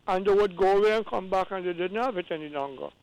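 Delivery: ordinary delivery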